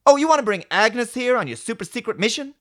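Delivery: high, loud mocking complaint; Raffi. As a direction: nag with incredulous questioning. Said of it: "Will Raffi once again complain about everything".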